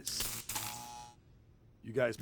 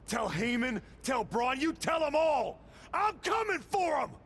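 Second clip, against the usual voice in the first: much higher, yelled threat; defiant shout; 10 semitones higher than usual. Acted. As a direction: bellow the challenge to everyone. Wrestler yells challenge after win.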